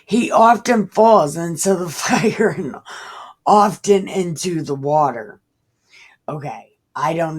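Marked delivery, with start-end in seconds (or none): 0.0-5.4 reading through a laugh. Acted. Reads father's plea, laughing.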